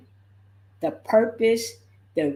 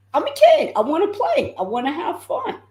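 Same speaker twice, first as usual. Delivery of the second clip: put-on much higher, little-kid voice pleading to play; high whiny child self; childlike voice; 11 semitones higher, 4 dB louder, faster than usual. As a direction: sound like a kid who just wants to play. Recalls her childhood self.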